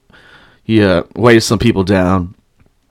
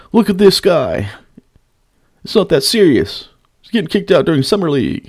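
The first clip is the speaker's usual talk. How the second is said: higher, whiny-mock onlooker voice; mocking reporter impression; 6 semitones higher than usual. Fan mimicking beat reporter filming him, mocking high voice. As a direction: mimic him in a raised pitch.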